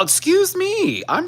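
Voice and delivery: very high, slow and loud excuse me; mock offended. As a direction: squeal it like a scandalised pet.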